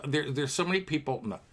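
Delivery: ordinary delivery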